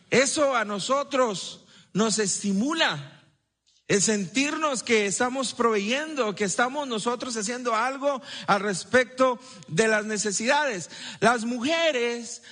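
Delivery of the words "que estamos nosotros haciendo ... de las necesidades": ordinary delivery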